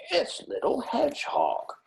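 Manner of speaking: a hint of amazement at the door; surprised badger. A narrator's reading. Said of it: "he said in surprise".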